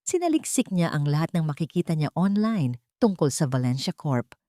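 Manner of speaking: plain reading voice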